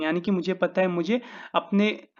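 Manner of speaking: ordinary talk, nothing marked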